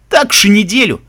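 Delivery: high, loud mocking doubt; amused disbelief. Second guard ribbing his comrade's boast, amused and loud. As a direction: tease him with an incredulous lift. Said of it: "засмеялся второй".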